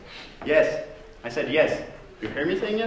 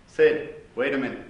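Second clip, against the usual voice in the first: ordinary talk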